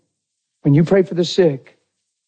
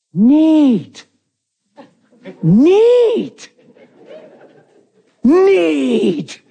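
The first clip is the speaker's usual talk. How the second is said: high, loud, overwrought wail of neat; milk each neat like a tragic actor; dramatic neat; 13 semitones higher, 4 dB louder, much slower than usual. Retold or imitated. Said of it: "Three times, like, dramatic".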